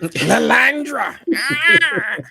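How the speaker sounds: high, slow, drawn-out cry of the name; mock dramatic yell. A fan mock-calling a character's name, high drawn-out yell. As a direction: call out the name like an anime villain.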